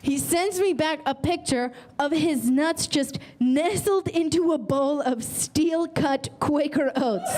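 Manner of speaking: ordinary talk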